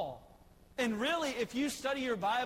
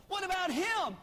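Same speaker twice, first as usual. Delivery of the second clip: higher, eager and a bit louder; eager question; 9 semitones higher, 4 dB louder than usual. Retold or imitated. Preacher as Samuel asking hopefully, higher and louder. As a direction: Samuel hopefully points at the next son. Samuel asks about each son.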